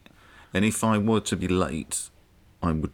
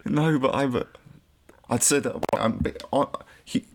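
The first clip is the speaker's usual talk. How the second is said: flustered, higher pitched; panicking; 8 semitones higher than usual. Real, not acted. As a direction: scramble to defend yourself. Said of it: "Listen to you panicking".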